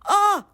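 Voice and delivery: high, loud "ah"; a sudden high yelp, imitating her; mock startled cry. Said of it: "she was like".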